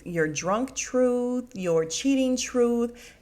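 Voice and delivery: drawn-out, slow mocking threat; slow taunt. [name] taunting Karen at dinner, slow and mocking. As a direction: savour each word as a threat.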